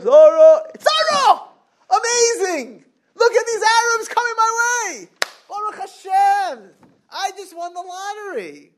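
very high, ecstatic calling out; overjoyed Avraham. Acted. Avraham calling Sarah as guests approach, ecstatic high cries. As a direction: shout it like a jackpot winner. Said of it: "it was totally happy".

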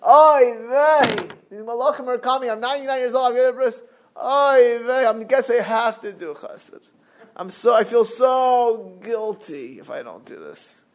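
kvetch like a guilt-ridden host; put-upon kvetch. Acted. Mock Avraham groaning over unwanted guests, put-upon. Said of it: "it's not that Avraham said".

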